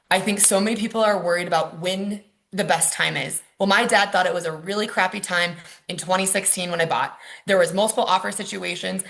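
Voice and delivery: ordinary delivery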